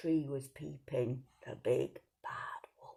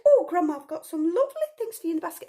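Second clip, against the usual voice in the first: put-on much higher, louder, fast excited child; little girl voice; 12 semitones higher, 9 dB louder, faster than usual. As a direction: gush like a happy child. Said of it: "and she said".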